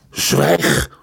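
low barked command; loud command. Acted. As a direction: cut him off hard. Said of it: "riep zijn vader zo hard".

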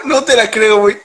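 ordinary talk, nothing marked

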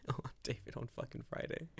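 hushed conspiratorial whisper. Real, not acted. Teasing David with the story, a breathy whisper. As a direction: lean in and whisper it.

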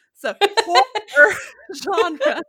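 HORROR loud and drawn out through laughter; laughing, stretch "horror" slowly into two syllables; over-careful pronunciation. Real, not acted.

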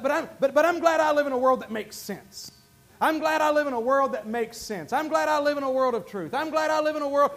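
ordinary talk

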